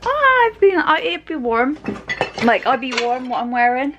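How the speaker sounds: high, loud, mocking her own thought; mocking her past self. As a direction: parody your naive past self. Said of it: "And I thought".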